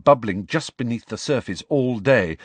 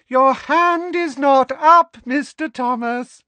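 very high, loud, drawn-out trill; piercingly high teacherly scolding; Professor Umbridge trilling; 9 dB louder, slower than usual. A narrator's reading. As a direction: sing out the reprimand at the top of the voice. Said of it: "trilled Professor Umbridge".

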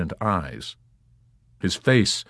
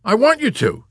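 high, loud, eager affection; glowing affection; 7 dB louder than usual. A narrator's reading. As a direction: gush it eagerly. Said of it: "Yossarian glowed with affection".

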